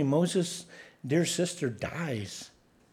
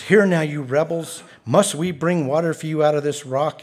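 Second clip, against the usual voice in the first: loud, higher, fed-up scolding; a leader who has had enough; exasperated rebuke; 4 semitones higher, 9 dB louder than usual. Acted. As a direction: Moses shouting at the rebels. Moses fed up, scolding people.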